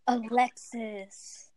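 name drawn out very slowly. Acted. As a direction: stretch the name out.

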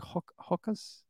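tentative "Hokas" as HAW-kuhz; slowly, quietly try out "Hokas" with a British vowel; attempted British Hokas. Shown as a demonstration. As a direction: feel for the vowel in "Hokas", stumbling once. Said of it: "I can't repeat it".

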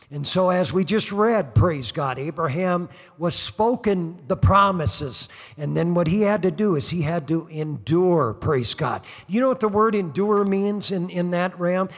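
ordinary delivery

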